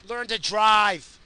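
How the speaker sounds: slow angry yell. Real, not acted. Yelling at a driver.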